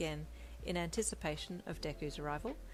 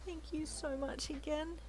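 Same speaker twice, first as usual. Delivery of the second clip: light, higher, slower woman's voice; young woman voice; 10 semitones higher than usual. A narrator's reading. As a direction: voice the grateful wife gently. Female lead voiced high.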